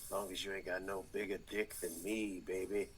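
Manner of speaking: low, hushed mock of Seagal's put-on urban accent. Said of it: "he's like".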